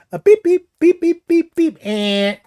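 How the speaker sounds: high chirpy beeps; quick high beeping; keypad beeps. Retold or imitated. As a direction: punch in a code with mouth beeps. Imitating keypad tones.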